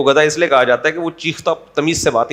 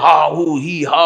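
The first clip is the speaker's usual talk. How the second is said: slow, drawn-out mock preacher noises; half-hearted parody of preacher bellowing; ranting preacher imitation; slower than usual. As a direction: imitate pulpit bluster without really shouting. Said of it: "ممبر پہ بلا وجہ چیخ رہے ہوتے ہیں".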